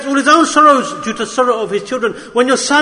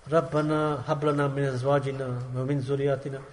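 quick recitation; 10 semitones lower, 13 dB quieter, much faster than usual. Acted. Quranic dua quoted.

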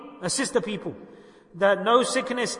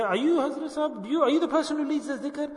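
imitating an admirer's higher-pitched question; curious stranger; 7 semitones higher than usual. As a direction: ask it high, like a curious stranger. Acts out strangers at the shops.

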